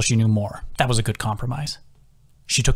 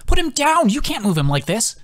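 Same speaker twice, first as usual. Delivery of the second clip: urgent shout; 12 semitones higher than usual. A narrator's reading. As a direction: stop them at once with a shout. Healer orders men urgently.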